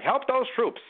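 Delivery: a hint of a 1920s New York propaganda announcer; New York nineteen twenties voice. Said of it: "doing the New York nineteen twenties voice".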